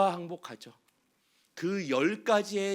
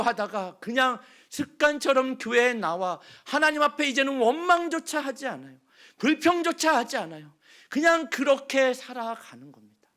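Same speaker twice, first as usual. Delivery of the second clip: loud, high sermon climax; full-force preaching; 7 semitones higher, 8 dB louder than usual. Acted. Pastor warning churchgoers about resignation, full-force preaching. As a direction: preach it hard to the pews.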